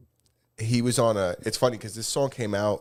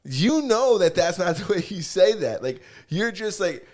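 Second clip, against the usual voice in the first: mock incredulous; 8 semitones higher than usual. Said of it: "And I was like".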